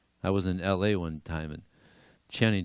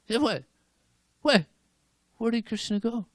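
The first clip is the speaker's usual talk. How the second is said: baffled surprise. Acted. Neighbor sees Krishna vanish.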